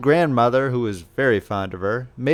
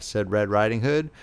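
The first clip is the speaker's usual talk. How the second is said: ordinary delivery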